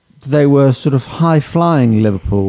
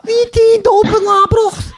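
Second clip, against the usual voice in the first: extremely high, squeaky Scouse; absurdly overdone falsetto Liverpudlian; mock Scouse accent. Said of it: "Can you do Scouse?".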